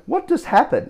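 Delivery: way up high, bewildered; dazed astonishment. Retold or imitated. Left holding the phone after the hang-up, high bewildered astonishment. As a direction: reel from the hang-up in disbelief.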